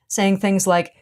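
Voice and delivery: ordinary talk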